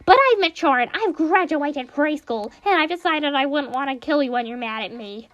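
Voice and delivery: very high, loud, whining Monokuma apology; high-pitched bratty bear begging forgiveness; whiny bear apology. Acted. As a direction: squeal out a grudging sorry like a spoiled cartoon bear. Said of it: "he gives in and goes to apologize".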